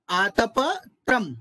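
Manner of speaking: आतपत्रम slow, broken as aatapa, then tram; split pronunciation. Shown as a demonstration. Teacher modelling for students, आतपत्रम slowly split before tram. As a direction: separate आतप from त्रम in आतपत्रम. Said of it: "आतप और त्रम इस तरह अलग रखना चाहिए".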